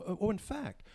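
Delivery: usual speaking voice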